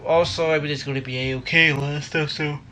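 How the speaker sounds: sleepy, mid-yawn. Real, not acted. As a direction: talk through a yawn. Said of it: "Sorry if I'm yawning".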